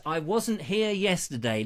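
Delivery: normal talking voice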